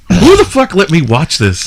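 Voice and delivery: high, loud comic outrage; acted disbelief. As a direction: exclaim it in mock shock. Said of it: "and you're like".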